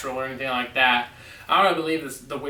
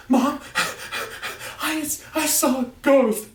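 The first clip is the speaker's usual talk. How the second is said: higher, stammering mock panic; retold panic; 9 semitones higher than usual. A boy telling his mom he saw a ghost, mock panic. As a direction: replay the scared kid running to mom. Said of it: "I was freaking out".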